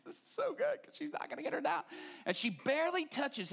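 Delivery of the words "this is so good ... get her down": very high, fast gleeful squeal; giddy anticipation. Retold or imitated. Husband watching Finnish lady pray for wife, giddy high squeal. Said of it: "and I'm like".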